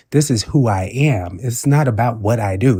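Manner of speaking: ordinary talk, nothing marked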